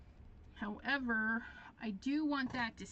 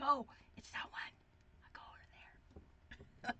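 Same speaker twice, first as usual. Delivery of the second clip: a secret, softer whisper to herself; excited whisper; 12 dB quieter, much faster than usual. Pretend. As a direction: whisper it like a happy little secret. Pretend delight at favorite cashier.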